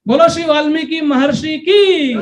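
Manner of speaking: a rousing jaikara for the assembly; devotional call-out. Real, not acted. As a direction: lead the crowd in a cheer for Valmiki. Calls out a devotional cheer.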